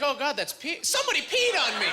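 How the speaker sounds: high, alarmed realization building to an outcry; comic panic. Acted. Comic reliving smelling the wet bed, hammed-up panic. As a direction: voice climbs into mock-horrified accusation. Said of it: "I'm panicking".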